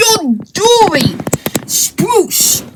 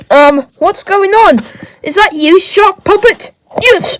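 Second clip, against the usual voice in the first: ordinary talk, nothing marked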